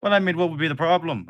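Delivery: brushing off the worry; Thatch voice. Acted. Thatch reassuring Teach, high fast and loud. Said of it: "And Thatch goes".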